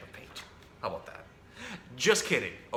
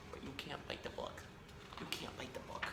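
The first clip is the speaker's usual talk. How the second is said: whisper; 15 dB quieter than usual. Real, not acted. Scolding the bird softly.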